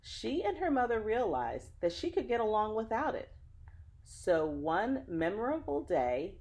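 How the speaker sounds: put-on lively read-aloud voice for children; story narration. Reading a picture book to children, lively storyteller. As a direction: tell the story warmly to young listeners.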